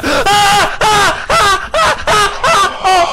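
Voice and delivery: high, loud startled "ah" cries; a run of short panicked yelps; panicked gasping cries. Real, not acted. Player frightened by the game, high panicked cries.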